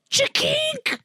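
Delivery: high, sharp mock shutter noise; imitated camera click. Taking a picture at the party, imitated shutter click.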